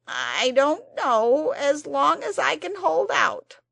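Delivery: slow, loud, strained creature; weary Psammead. A narrator's reading. The Psammead wearily answers Anthea's question. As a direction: sound worn out already.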